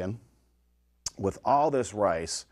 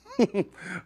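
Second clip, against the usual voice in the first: high laugh; 11 semitones higher than usual. Real, not acted. Laughs at own joke.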